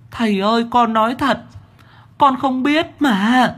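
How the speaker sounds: put-on very high, whining child-demon voice; pleading demon child. A narrator's reading. Demon child swears to the master it knows nothing. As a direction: insist it's the truth, whining.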